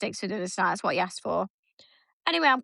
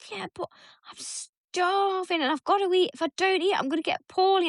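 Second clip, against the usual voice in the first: put-on whiny kid voice; 8 semitones higher than usual. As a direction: whine like a dramatic starving child. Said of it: "And he's like".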